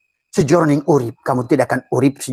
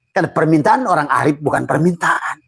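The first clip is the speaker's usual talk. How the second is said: ordinary talk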